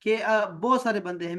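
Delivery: normal talking voice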